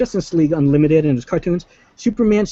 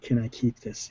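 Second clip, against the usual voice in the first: much softer, lower murmured quote; hushed mock Flash; 3 semitones lower, 10 dB quieter than usual. Fans replaying the Flash line, hushed. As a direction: hush it like a sheepish kid.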